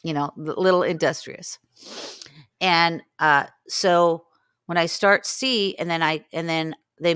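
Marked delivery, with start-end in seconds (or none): none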